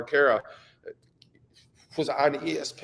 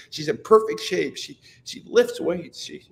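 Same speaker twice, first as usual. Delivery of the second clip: normal talking voice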